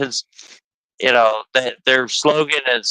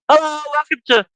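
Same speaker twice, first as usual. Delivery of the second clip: higher chirpy cashier greeting; greeting clerk; 12 semitones higher than usual. Retold or imitated. Host imitating Love's cashiers greeting customers, higher chirpy voice. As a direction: sing out the store greeting. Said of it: "they're like".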